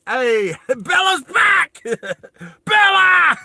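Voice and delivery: high, loud delighted call; excited laughing greeting; excited shout. Real, not acted. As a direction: shout her name with joy. Greets returning viewer excitedly.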